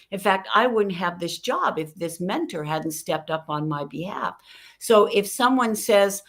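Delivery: ordinary talk, nothing marked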